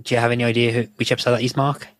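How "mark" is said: ordinary talk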